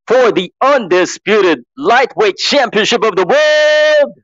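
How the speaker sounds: Mark Chinook. Acted. Copying a ring announcer for the fight crowd, showy introduction. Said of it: "I'm just copying Mark Chinook".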